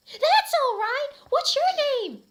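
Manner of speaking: hammed-up squeaky girl voice, very high and loud; a breathless goth girl putting on a cute voice; Ebony. Voicing Ebony meeting Harry.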